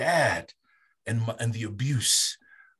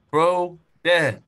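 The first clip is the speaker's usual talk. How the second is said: louder, higher call to dad; raised call to his father; 5 semitones higher, 8 dB louder than usual. Retold or imitated. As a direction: shout to get dad's attention.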